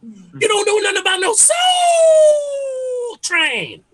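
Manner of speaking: very high, loud holler with "Soul" stretched out long; annoying old head. Acted. Dad quizzing his boy on Soul Train, obnoxiously hollering. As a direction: wail "Soul" endlessly like a hammed-up old head. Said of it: "but in an annoying, obnoxious way".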